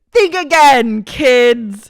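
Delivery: loud, high, taunting; mock tough comeback. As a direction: throw it at them like a victory line. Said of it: "And she goes".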